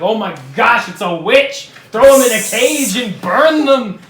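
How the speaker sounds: rising into a loud, slow mock-horrified cry; hammed-up panic over a card trick; mock outraged priest. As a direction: play the scandalized priest burning the witch.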